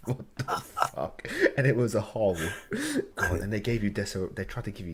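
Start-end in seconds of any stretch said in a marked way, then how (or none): 0.1-1.3 shocked whisper. Retold or imitated. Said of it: "And I'm like".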